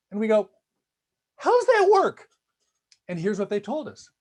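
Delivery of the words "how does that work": much higher, louder disbelieving question; baffled question. Retold or imitated. Professor asking students about their choice: high baffled disbelief. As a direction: squeak it out in total disbelief.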